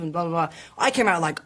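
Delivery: normal talking voice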